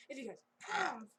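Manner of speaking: sudden loud demonic burst on one word; demon outburst. Real, not acted. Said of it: "Did a demon just come out of me".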